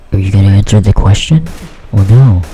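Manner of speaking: low, loud, quick demand; imitated pushy teacher. Teacher pressing student about the book, low and demanding. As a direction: put on an impatient teacher's voice.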